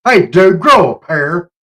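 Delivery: loud taunting call, rising at the end; 1 shouted jab. Real, not acted. Mocking a politician, a loud taunting shout. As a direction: yell it at him like a dare.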